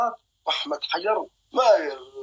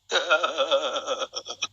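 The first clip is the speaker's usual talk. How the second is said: low, softer rattling laugh; staccato cackle; 5 semitones lower, 5 dB quieter than usual. Real, not acted. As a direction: chuckle low in long, even bursts. Taunts opponent in battle. (ضحكة خافتة منخفضة متقطعة)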